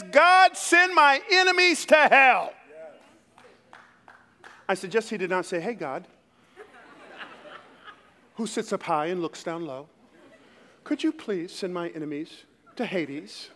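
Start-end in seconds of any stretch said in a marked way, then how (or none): 0.0-2.5 high, heated cry to God; ticked-off prayer. Acted. David praying against his enemies, ticked-off outcry. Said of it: "I believe he's ticked". 5.6-13.5 soft, polite British prayer; mock British accent. Preacher parodying a too-polite prayer to God, British accent. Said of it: "He didn't say it with a British accent".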